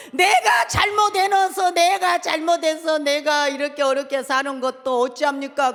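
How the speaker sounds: shouted prayer. Shown as a demonstration. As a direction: plead with God aloud. Preacher models prayer for debts. (부르짖는 기도)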